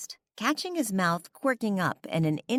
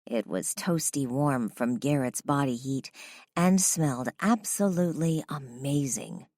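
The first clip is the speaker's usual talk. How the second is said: plain reading voice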